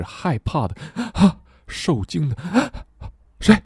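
startled gasps between the words; frightened. Shown as a demonstration. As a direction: act out a sudden fright. Acts out the word frightened.

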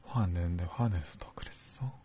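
gentle whispered question; whispering. Acted.

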